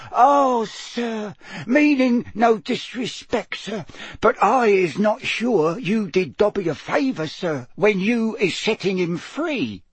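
high, deferential elf voice; squeaky house elf. A narrator's reading. House elf doubts Harry did a favour, squeaking respectfully. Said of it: "a teeny quivering squeak of a voice".

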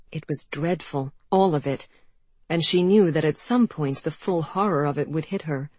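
usual speaking voice

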